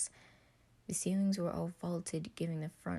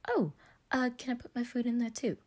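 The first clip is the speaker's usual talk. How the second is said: higher, louder eager question; eager younger voice; piping up; 7 semitones higher, 5 dB louder than usual. Acted. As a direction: pipe up brightly. Roman pipes up.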